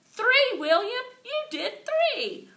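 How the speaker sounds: high, loud cheer, the last words slow; excited cheer. A narrator's reading. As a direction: yell with pure delight. Cheering William's pull-ups.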